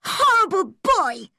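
put-on high, loud outburst from a furious girl; furious schoolgirl, pitch shooting up; angry cry. A narrator's reading. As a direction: cry it out in indignant fury. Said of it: "she cried".

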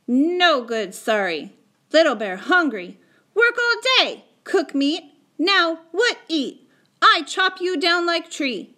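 high, loud, indignant outburst; angry scolding. Acted. Little Bear threatening the boy over food, angry scolding.

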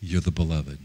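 low, hushed voice from heaven; voice of God. Retold or imitated. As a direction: quietly voice the Father, pitched low. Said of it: "to hear the Father say".

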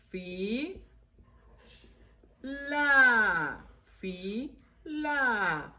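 "fila" in long held syllables with big gaps. Shown as a demonstration. Teacher sounding out while writing, "fila" very slowly split. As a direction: draw out fii, then laa in "fila". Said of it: "dos sílabas".

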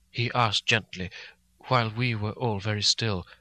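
ordinary delivery, nothing marked

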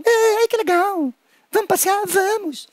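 high, slow cartoon worm voices; high cheerful finger-puppet worm voice; chatty worms. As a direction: make the little worms greet each other happily. Two worms meet.

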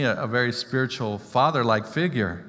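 ordinary talk, nothing marked

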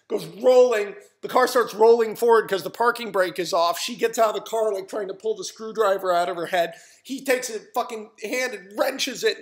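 loud, high, urgent storytelling; excited action telling. Retold or imitated. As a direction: belt out the fight blow by blow. Breathless telling of the fight.

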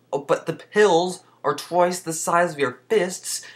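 high, loud and slowed disbelief; exaggerated outrage. Real, not acted. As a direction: stretch out the indignation. Exaggerated complaint about huge pills.

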